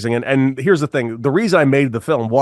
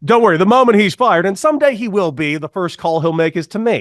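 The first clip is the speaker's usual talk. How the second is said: mock reassurance; 6 semitones higher, 4 dB louder than usual. Mock reassurance about manager.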